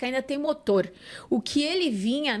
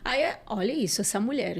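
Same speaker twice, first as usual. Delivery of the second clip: normal talking voice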